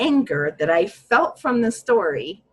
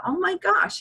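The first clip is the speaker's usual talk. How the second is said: surprised gasp of realization; 12 semitones higher than usual. Retold or imitated. Retelling her own realization in the group session, high surprised exclaim. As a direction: pitch jumps high on oh my gosh. Sudden realization retold as thought.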